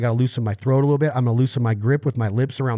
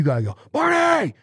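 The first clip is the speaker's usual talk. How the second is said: calling a dog; 13 semitones higher than usual. Shown as a demonstration. Handler sending a dog on a long retrieve, high inflected call. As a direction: sing out the name high to send a dog off. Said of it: "that inflection in your voice".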